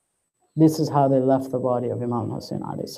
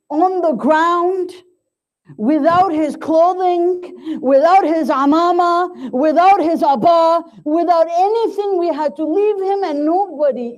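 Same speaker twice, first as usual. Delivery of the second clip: mournful preaching climax; 8 semitones higher than usual. Acted. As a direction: preach the loss rising higher and higher, then let it fall away. Preacher's rising lament climax.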